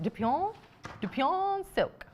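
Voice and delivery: drawn-out French-style fabric name; French-sounding accent. Shown as a demonstration. Presenter asking [name] about the accent, playing up the French sound. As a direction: savour it slowly with a French lilt. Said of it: "say it in that accent as well".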